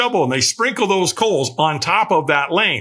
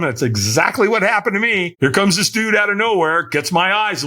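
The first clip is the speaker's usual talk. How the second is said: ordinary talk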